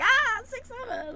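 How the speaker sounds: high, drawn-out mock exclamation; slow, high-pitched goofy quote; imitating own reaction. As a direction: act out a silly high reaction. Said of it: "so I'm like".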